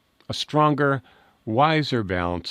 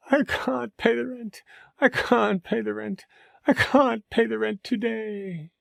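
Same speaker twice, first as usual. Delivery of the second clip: high, slow, pleading damsel voice; damsel in distress; 11 semitones higher than usual. Acted. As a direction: beg helplessly. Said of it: "the damsel in distress".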